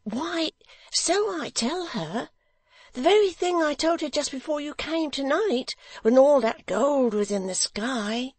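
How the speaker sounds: put-on high, eager simple young man; Barnaby's voice. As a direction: lift the voice high and earnest.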